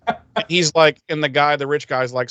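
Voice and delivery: usual speaking voice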